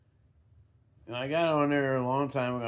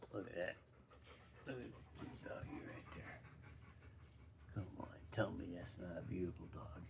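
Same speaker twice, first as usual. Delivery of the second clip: whispered pet talk; 8 semitones lower, 20 dB quieter than usual. Real, not acted. Speaker shows off the dog to the viewers, doting hushed whisper. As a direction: whisper sweetly so you don't startle her.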